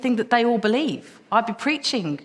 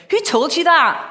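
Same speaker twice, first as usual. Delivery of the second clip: sharp, louder Glasgow challenge, pitched up; Glasgow accent; 6 semitones higher, 6 dB louder than usual. Retold or imitated. Preacher imitating his Glasgow youth leader, blunt Glasgow accent. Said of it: "he was from Glasgow, he'd go".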